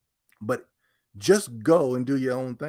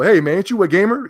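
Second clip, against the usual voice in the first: loud, higher jeering stranger; mock a passerby teasing him; 7 semitones higher, 10 dB louder than usual. Mocking strangers tease him in the street, loud jeer. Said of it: "people go".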